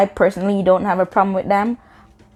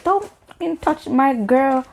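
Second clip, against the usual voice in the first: higher mock-confrontational voice; mimicking the boyfriend; 7 semitones higher than usual. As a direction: imitate him butting in, pitched up. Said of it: "He comes up in my face".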